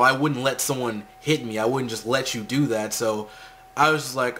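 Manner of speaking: normal talking voice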